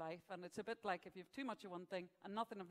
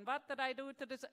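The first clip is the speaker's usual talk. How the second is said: higher voice with a mock Italian accent; bad Italian-accented Pope; 4 semitones higher than usual. Pope complaining about his singer, mock Italian accent. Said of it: "I can't do a German accent".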